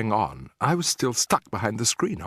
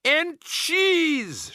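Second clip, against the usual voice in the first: high, shrill witch shout. Acted. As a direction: shout it out gleefully. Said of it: "shouted the Grand High Witch".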